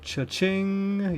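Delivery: high, gleeful cheer; cash-register cheer of triumph; excited. Real, not acted. Celebrating the card pull.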